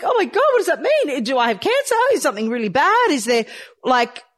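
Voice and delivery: reliving the shock of the diagnosis, half-laughing; panicked, high-pitched. Retold or imitated. [name] retelling hearing her diagnosis, high panicked voice. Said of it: "I was like".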